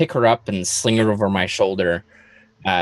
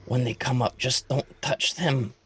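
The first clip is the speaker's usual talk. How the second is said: soft, hushed aside; quiet private warning under the breath; whispering to oneself; 7 dB quieter than usual. Acted. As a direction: mutter it softly to yourself. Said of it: "whisper, um, to myself".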